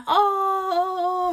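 high drawn sympathetic oh; mock sympathetic onlooker. Onlookers in changing room reacting, high pitying oh. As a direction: sigh it out like a pitying stranger. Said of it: "everyone's like".

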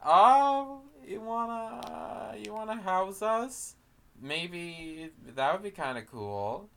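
high, soft, hesitant put-on voice; hammed-up bashful asking; mock shy request. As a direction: ask timidly up in a little voice. Orcs shyly asking trolls.

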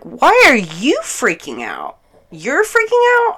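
loud retort climbing to a very high pitch; outraged disbelief. Retold or imitated. Answering his panic with her own, outraged disbelief. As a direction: throw his words back at him, incredulous.

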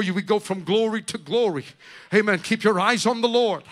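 loud, rising preacher's exhortation; preaching at full force. Acted.

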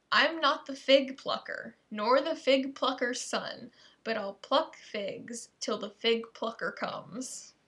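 slow, every word placed with care; careful slow reading. Shown as a demonstration. Reading a risky tongue twister to viewers, slow and careful. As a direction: take it slowly so no word slips. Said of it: "I'm gonna read this one slowly".

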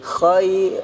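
ordinary delivery

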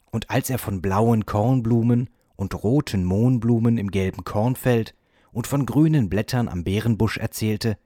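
usual speaking voice

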